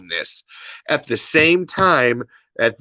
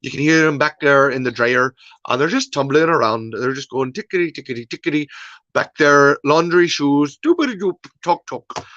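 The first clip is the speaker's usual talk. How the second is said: badly faked Irish lilt; playful, hammed-up Irish accent; terrible Irish accent. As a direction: do a bad Irish impression. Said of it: "that was a terrible Irish accent".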